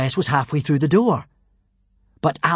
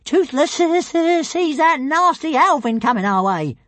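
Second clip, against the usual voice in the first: little dragon Toothless; 12 semitones higher, 4 dB louder than usual. Acted.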